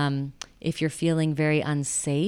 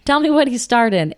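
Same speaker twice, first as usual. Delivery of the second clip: exasperated demand; 7 semitones higher, 9 dB louder than usual. Retold or imitated. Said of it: "I'm like".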